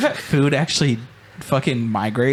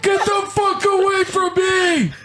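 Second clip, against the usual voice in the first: put-on high, slower shrieking old man; old man yelling. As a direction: drag out the shriek, old geezer. Playing an old person.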